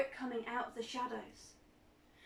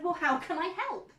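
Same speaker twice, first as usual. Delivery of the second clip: louder, higher eager question; eager offer; 6 semitones higher, 7 dB louder than usual. Acted. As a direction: jump in keen to help. Janet offers help.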